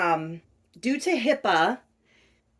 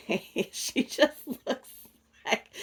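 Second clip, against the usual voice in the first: higher, softer words breaking up with laughter; laughing; 3 semitones higher, 6 dB quieter, slower than usual. Real, not acted. Vlogger showing viewers her doll's little face, laughing. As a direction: giggle through the words, drawing them out. Giggling over the doll's expression.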